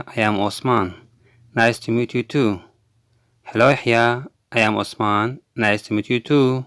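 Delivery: normal talking voice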